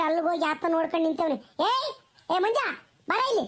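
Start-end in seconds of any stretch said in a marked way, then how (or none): 1.6-3.5 calling out. Acted. Mother calls son from afar.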